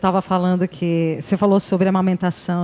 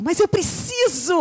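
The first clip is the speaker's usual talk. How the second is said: put-on child pleading; 9 semitones higher than usual. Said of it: "Aí ele falou".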